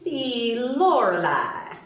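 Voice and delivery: put-on lightly done Southern belle accent; a hint of an Alabama belle drawl; playful put-on Southern belle accent; fake Southern drawl. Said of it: "begins talking in a fake Southern drawl".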